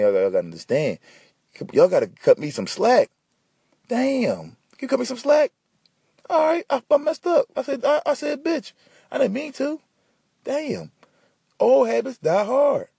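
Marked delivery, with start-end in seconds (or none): 3.9-12.9 very high-pitched defensive squeal of words; exasperated protest. Acted. Man excusing his slip to the listeners, high exasperated protest. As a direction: plead for slack, voice pitched way up.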